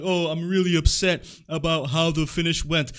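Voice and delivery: sarcastic whiny mimic of a wrestler; mock his complaint in a whiny put-on voice; mocking whiny Seth Rollins. Mocking Seth Rollins' complaint about the finish in a whiny put-on voice.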